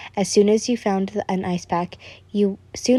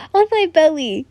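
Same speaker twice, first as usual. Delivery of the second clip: higher, blurted out loud; shouting; 8 semitones higher, 5 dB louder than usual. A narrator's reading. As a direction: blurt it out in embarrassment. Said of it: "You didn't expect him to shout it out".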